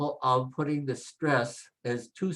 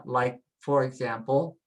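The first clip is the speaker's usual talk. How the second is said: ordinary talk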